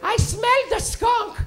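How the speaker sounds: high, loud mom voice; imitated mom. Mom calling through her son's door, high and loud. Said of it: "my mom".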